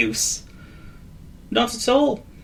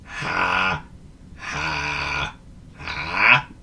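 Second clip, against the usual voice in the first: flat, lower, fading ha ha; miserable forced laugh; 4 semitones lower, much slower than usual. A narrator's reading. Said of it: "the most miserable attempt at a laugh".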